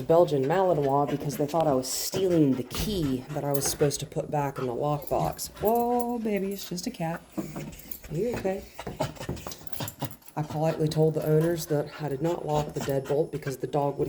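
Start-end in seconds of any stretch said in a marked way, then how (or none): 5.6-8.6 soothing dog. Real, not acted.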